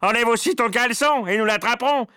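loud, high-pitched call from the crowd; shouted advice. A narrator's reading. Onlooker telling the cook to strip, shouting. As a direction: egg the victim on noisily. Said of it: "cria quelqu'un".

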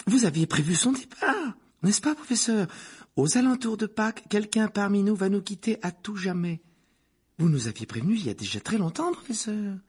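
very high-pitched, breathless schoolgirl awe; excited exclamation. A narrator's reading. Lavande recalling the professor's prophecy, excited. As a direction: gush with sudden realisation. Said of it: "s'exclama soudain Lavande".

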